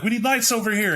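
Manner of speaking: high urgent call for lights; cop calling out. Acted. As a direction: shout across the water to other officers, pitch up.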